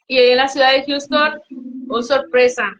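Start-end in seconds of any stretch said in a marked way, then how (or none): none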